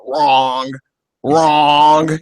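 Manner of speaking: drawn-out, dismissive put-down, lightly mimicked; Donald Trump. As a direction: imitate a debater's curt interjection, stretched out. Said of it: "Trump, he was fucking hilarious".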